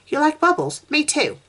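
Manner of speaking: put-on high, bright girl's voice; delighted little girl. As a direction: share her joy like an excited child.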